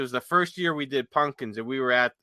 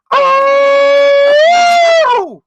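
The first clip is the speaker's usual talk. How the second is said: long loud howling whoop; bigfoot call; 14 dB louder than usual. Shown as a demonstration. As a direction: belt out a Bigfoot howl. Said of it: "How's the call go?".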